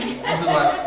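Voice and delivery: usual speaking voice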